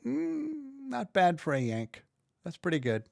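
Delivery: a light mock British critic; grudging British approval, lightly voiced; hint of a Brit praising a Yank. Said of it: "British folks, they might go".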